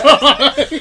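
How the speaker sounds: loud, high laughing outburst; retold shocked reaction. Friend retelling his reaction to coach gossip, laughing loudly. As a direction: crack up while exclaiming it.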